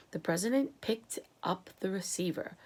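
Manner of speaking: plain reading voice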